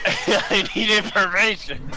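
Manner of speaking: can't keep a straight face while threatening; laughing mid-intimidation; laughing through it. Acted.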